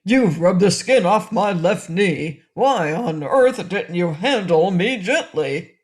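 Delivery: loud cross talking goat; angry goat. A narrator's reading. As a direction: complain loudly as the hurt goat. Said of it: "cried the voice angrily".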